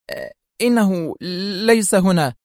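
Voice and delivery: falter, then force the words out; nervous, hard-swallowed. A narrator's reading. Principal admits to Zelman the boy is absent, nervously. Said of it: "ازدردت لعابها في صعوبة قائلة".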